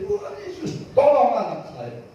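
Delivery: put-on reliving a father crying out for his child; retold desperate cry. Father crying to Jesus in hospital, high desperate plea. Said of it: "Saya di situ menjerit".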